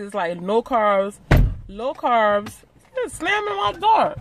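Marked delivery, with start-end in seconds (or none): 2.9-4.1 scolding. Real, not acted. Scolding the kid for slamming.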